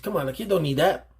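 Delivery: ordinary talk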